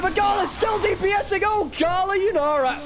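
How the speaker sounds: excited shout. Real, not acted. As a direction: shout it out as the fight breaks open. Caster hyped by teamfight play.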